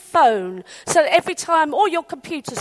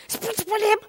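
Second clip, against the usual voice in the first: high, softer, thrilled hiss; Chinese whispers; 6 semitones higher, 5 dB quieter than usual. Retold or imitated. Neighbour passing on the prince sighting, hushed excitement. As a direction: pass it on like a secret. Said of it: "it's Chinese whispers".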